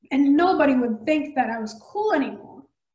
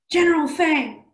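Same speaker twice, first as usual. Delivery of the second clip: usual speaking voice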